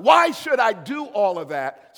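slow, loud protesting shout; indignant shout. Acted. Preacher voicing a protesting church member, shouting. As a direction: throw the question back angrily.

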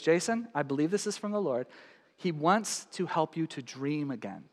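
soft, hushed quoted word from the Lord; quiet, earnest retelling of her word; lightly voiced friend. Friend across the table speaking softly to Jason. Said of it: "She goes".

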